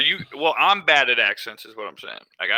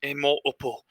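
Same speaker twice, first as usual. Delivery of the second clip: put-on putting on the co-host's British accent, pitched up; co-host's accent impression; 3 semitones higher than usual. Imitating co-host's accent for a video intro, British accent impression. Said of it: "is that your impression of my accent".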